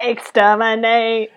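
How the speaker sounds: drawn-out robotic Dalek cry. Acted. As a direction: chant it like a Dalek, stretched out. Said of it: "just sounds like a Dalek".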